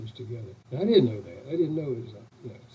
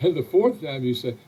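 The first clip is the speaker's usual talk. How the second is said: ordinary talk, nothing marked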